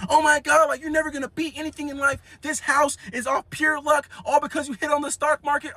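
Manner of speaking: high, shrill-edged mock of a girlfriend scolding. Girlfriend yelling at her man.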